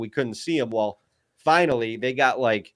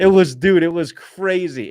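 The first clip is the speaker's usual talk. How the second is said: raised, loud burst of amazement; real excitement; 7 semitones higher, 6 dB louder than usual. Real, not acted. Hunter telling listeners about a wild hunt, excited.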